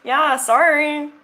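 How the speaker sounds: slow, loud mock apology; sarcastic. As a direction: fake sorry, dripping with sarcasm. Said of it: "Hashtag sarcasm".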